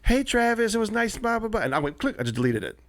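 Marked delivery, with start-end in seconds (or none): 0.0-1.6 high, put-on woman's voice; mock female caller; woman on voicemail. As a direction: imitate her message, pitched up and glossed over. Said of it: "I have a voicemail on my work phone from this woman".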